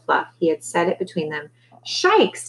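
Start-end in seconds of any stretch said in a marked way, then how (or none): 1.8-2.5 high, falling exclamation of awe; amazed little cry; ogling amazement. A narrator's reading. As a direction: marvel at an unexpected treat. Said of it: "Deli oogled it".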